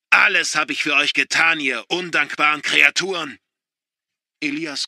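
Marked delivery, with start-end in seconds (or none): 0.1-3.4 very loud, higher raging yell; furious shout. A narrator's reading. Father raging at ungrateful children, furious shout. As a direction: explode at them.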